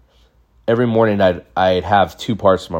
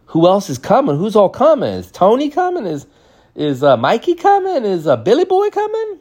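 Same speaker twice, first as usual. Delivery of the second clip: rising higher and higher with worry; anxious kid; 14 semitones higher than usual. Retold or imitated. Kid at a party asking the host who else is coming, panicking higher. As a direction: voice climbing as panic sets in.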